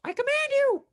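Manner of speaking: high-pitched witchy command; Space Witch voice. Acted. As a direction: order them in a shrill witch voice. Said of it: "try the Space Witch voice".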